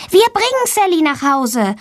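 put-on high, eager child's voice; eager schoolgirl. A narrator's reading. Valentina offers to bring Sally home, eager child voice. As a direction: pitch it up like a keen little girl.

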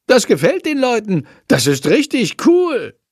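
high-pitched, delighted little voice; excited. Acted. Shandor thrilled by the online comments, excited. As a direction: gush with delight over being popular.

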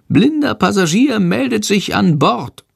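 high, cheeky little bat; a tiny bat voice, pleased with itself; squeaky bat voice. Acted. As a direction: chirp it like a stowaway bat. Schandor the bat announces himself.

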